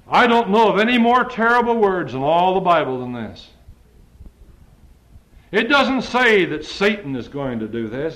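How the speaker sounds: high, loud preaching at full force; fiery preaching. Acted. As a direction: thunder the scripture at the congregation.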